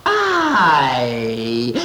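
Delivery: startled cry. Acted. Sudden realization cry.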